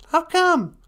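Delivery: very high, bright little puppet voice; high cartoon helper voice; squeaky puppet sidekick. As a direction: pipe up as the tiny sidekick. Puppet sidekick asks a question.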